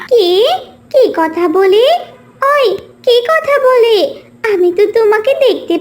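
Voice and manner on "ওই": ordinary delivery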